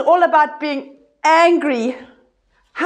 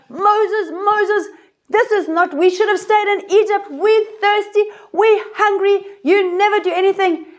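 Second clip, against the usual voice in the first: complaining crowd; 6 semitones higher than usual. Acted. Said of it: "And they're like".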